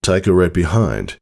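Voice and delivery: usual speaking voice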